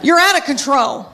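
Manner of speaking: mild scolding holler for comic effect; mock yell. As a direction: mom pretending to tell off her son. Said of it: "if I had to yell at my boy".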